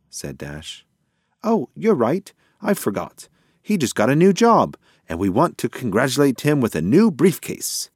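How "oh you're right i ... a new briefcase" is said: higher, animated pigeon character; confident pigeon. A narrator's reading. Pigeon makes a wrong guess to his friend, confident and animated. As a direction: blurt the guess in a raised cartoon-bird voice.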